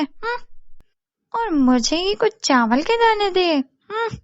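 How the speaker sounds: lightly voiced sly, slighted neighbour; sweet thanks turning into resentful grumbling with huffs; scheming neighbour. Babli grumbles about old woman's stinginess, scheming neighbour voice.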